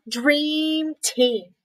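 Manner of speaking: ordinary delivery, nothing marked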